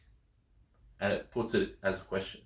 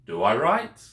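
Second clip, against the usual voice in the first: "write" lifted in a clear rising question; questioning intonation; 5 dB louder than usual. Shown as a demonstration. Greek teacher turning a statement into a question, questioning intonation. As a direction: raise the pitch sharply on "write". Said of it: "Make it a question".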